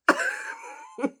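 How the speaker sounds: a sudden, very high burst of disbelieving laughter; incredulous high laugh. Real, not acted. Baffled by the "demonic" claim.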